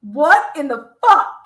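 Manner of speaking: ordinary talk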